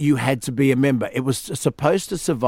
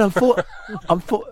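laughing; 6 semitones higher than usual. Retold or imitated. Retelling [name]'s reply, laughing.

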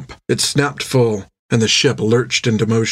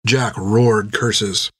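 usual speaking voice

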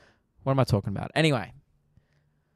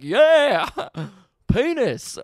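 loud, high whooping shout; mock supportive cheer; 10 semitones higher, 7 dB louder, much slower than usual. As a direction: cheer him on with exaggerated support. Acts himself cheering on stripper.

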